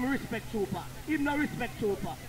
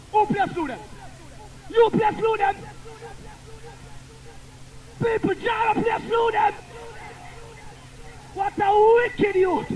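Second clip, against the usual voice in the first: much louder and higher shouting; angry accusation; 8 semitones higher, 4 dB louder than usual. Real, not acted. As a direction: call out the rival in front of the crowd. Selector accuses rival in clash.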